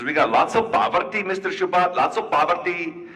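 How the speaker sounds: lightly imitated Indian accent; mock Indian English, matter-of-fact. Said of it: "You know that Indian accent?".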